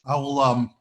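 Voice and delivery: talking with a mouthful; mouth full. Real, not acted. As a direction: speak around a bite of food. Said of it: "I have a bite in my mouth".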